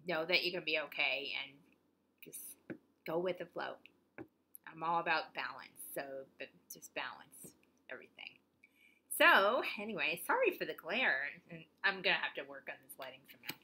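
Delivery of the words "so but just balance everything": soft unvoiced aside; hushed aside; whisper. Real, not acted. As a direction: breathe it out as an afterthought. Quiet aside after main point.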